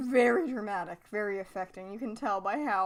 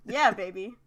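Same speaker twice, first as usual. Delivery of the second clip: Austin Powers voice; 5 dB louder than usual. Retold or imitated. Austin Powers impression, "oh behave.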